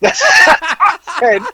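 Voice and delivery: high, slow, laughing squeal; real laughter, voice high; laughing hard. Real, not acted.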